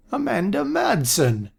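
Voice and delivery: put-on high, startled old man voice; surprised old man. A narrator's reading. Aaron spotting Amanda again, high surprised old voice. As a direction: greet her as if for the first time. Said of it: "in a surprised tone".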